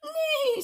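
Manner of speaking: very high, loud whinny; shrieky storybook whinny; horse neigh. A narrator's reading. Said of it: "said the horse".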